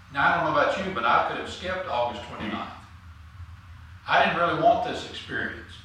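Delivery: ordinary delivery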